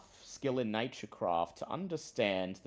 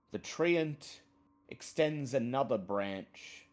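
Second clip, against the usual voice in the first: ordinary talk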